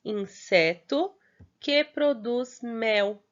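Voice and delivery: inseto que produz mel read slowly and carefully; teacher reading a riddle slowly. Shown as a demonstration. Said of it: "Fala assim".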